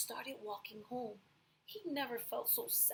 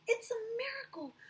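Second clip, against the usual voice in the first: much higher and louder, astonished; amazed shout; 9 semitones higher, 5 dB louder than usual. Acted. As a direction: shout in wonder at the sight. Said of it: "They shouted".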